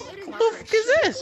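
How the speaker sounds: high, laughing what-the; cracking up at a weird item; laughing disbelief. Real, not acted. Gamer laughing at a strange new item, high disbelief.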